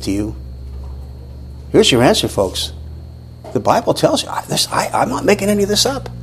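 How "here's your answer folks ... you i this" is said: ordinary talk, nothing marked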